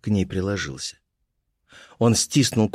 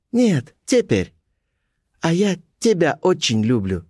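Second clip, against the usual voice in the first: Colibri's voice; 7 semitones higher than usual. A narrator's reading. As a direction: hold a high character voice, unhurried. Colibri insists, declares love.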